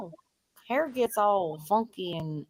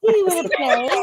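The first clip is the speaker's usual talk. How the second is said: creepy clown voice; 7 semitones higher, 8 dB louder than usual. Pretend. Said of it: "some crazy lady trying to steal my nerds".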